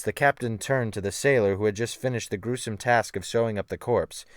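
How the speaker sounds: usual speaking voice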